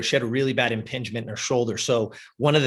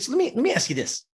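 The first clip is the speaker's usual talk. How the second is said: fast; 11 semitones higher, much faster than usual. Retold or imitated. Rushing into the real question.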